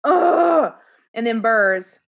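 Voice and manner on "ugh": loud high groan of frustration; loud frustrated groan. Real, not acted. Host moaning aloud in frustration over the song, loud. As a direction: let out a loud anguished ugh.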